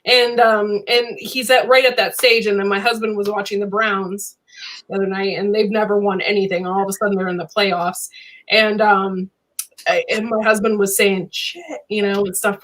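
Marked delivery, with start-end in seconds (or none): none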